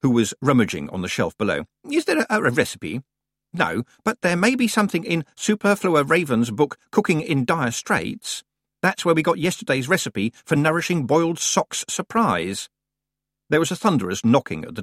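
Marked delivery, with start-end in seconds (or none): 1.9-3.0 high, hesitant, distracted old librarian; Senior Librarian Swinsley. A narrator's reading. 3.5-12.7 a lightly raised fussy librarian voice; Deputy Librarian Grisler. A narrator's reading.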